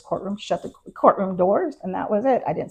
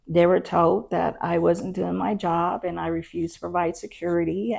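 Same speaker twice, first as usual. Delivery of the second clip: normal talking voice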